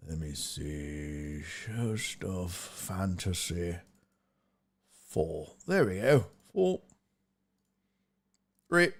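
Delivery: very low, soft, slow self-talk; absent-minded. Real, not acted. Host looking through a spreadsheet, absent-minded self-talk. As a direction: talk to yourself quietly while searching.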